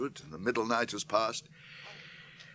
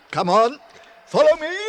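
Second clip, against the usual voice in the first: loud, high urging call; urgent shout; 11 semitones higher, 10 dB louder than usual. Acted. Gandalf urging the company over the chasm, loud shout. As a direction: yell them across the gap. Urging hobbits to jump crack.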